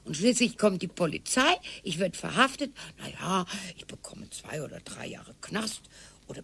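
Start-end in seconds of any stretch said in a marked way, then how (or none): 3.0-6.4 whisper. Acted. Scheming wife plots aloud.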